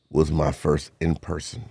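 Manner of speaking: usual speaking voice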